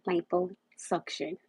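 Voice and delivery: liposuction slowly, as "lipo-SUK-shun"; careful self-correction. Real, not acted. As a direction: sound out liposuction slowly with "uh" in "suc". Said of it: "I don't know why I keep saying section".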